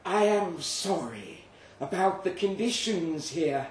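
the creature apologizing, high and drawn out. Acted.

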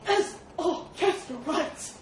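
loud, high pained agreement; wincing boar. A narrator's reading. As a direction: yelp agreement while being pinched. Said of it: "winced the boar".

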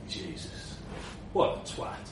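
unvoiced, soft aside; muttered whisper. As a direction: whisper it with scorn. Queequeg mutters about Magnet.

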